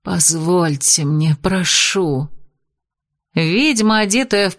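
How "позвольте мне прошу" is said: медленно, томно и ниже; соблазнительно тянуть слова. Acted. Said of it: "томно произнесла Шидар". (ведьма томно обращается к повелителю)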